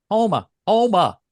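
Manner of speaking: "Homa" high with a steep fall; exaggerated echo. Co-host echoing how the town was said, exaggerated "Homa". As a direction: punch "Homa" high, dropping sharply. Mimics co-host's pronunciation of Homa.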